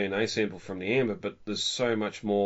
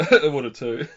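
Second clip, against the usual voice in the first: laughing; 6 semitones higher, 9 dB louder than usual. Real, not acted. Laughing agreement.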